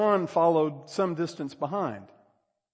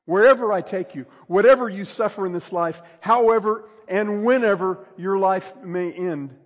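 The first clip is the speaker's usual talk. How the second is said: high, loud sermon climax; full-force preaching; 5 semitones higher, 10 dB louder than usual. Real, not acted. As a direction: thunder it out as a charge to the congregation.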